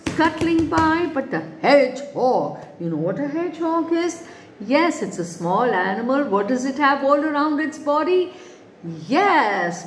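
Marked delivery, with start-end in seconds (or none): none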